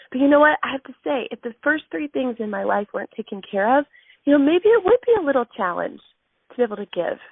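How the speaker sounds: ordinary talk, nothing marked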